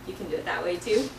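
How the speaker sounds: ordinary delivery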